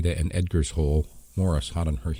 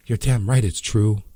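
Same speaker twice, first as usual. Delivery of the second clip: louder, firm and stern; 5 semitones higher, 5 dB louder than usual. Acted. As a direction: shut down the doubt firmly. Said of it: "Bea said sternly".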